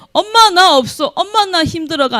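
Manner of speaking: high, whining complaint to mom; whiny child. Retold or imitated. A child whining to mom, lightly voiced. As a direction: whine like a broke kid. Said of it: "아니고".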